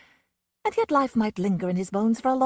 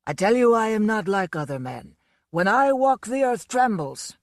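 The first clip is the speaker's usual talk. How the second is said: put-on drunken boasting; 3 semitones higher, 6 dB louder than usual. A narrator's reading. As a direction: make the room shake with the brag. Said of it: "was repeating over and over".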